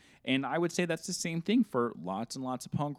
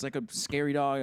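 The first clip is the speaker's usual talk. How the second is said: ordinary talk, nothing marked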